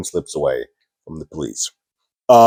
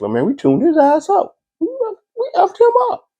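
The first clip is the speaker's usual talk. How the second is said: much higher, gleeful boast; giddy high-pitched bragging; 4 dB louder than usual. Retold or imitated. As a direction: crow about the beating, pitched way up. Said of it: "happily just kinda gives him".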